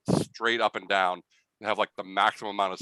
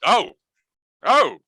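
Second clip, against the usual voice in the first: surprised mouse leader; 11 semitones higher, 9 dB louder than usual. Acted. Mouse leader recognizes Taigi.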